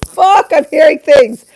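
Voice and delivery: high, loud freaked-out shout; hammed-up panic at hearing things; panicked. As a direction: yell it as you realize you're imagining voices. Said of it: "I was like".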